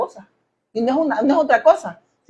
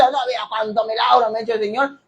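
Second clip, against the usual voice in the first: mocking boast; 4 dB quieter than usual. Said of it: "gente que se mofa y dice".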